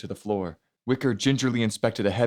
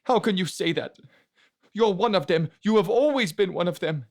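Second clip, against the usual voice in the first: high, tear-choked accusing cry; grieving brother lashing out at Elisa through tears; tearful accusation; 10 semitones higher than usual. Acted. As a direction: sob it out as an accusation. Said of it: "burst out in a depressing chorus of tears".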